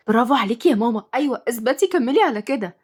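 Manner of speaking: ordinary delivery, nothing marked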